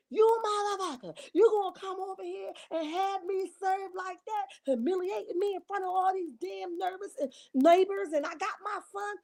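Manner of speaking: high-pitched, furious retold confrontation; angry yell. Wife yelling at husband after being served, furious. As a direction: hurl it at him, outraged and humiliated.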